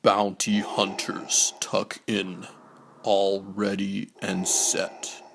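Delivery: plain reading voice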